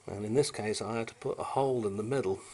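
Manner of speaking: normal talking voice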